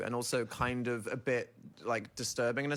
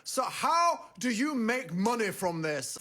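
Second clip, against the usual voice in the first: put-on old northern man; 8 semitones higher, slower than usual. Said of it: "He was like".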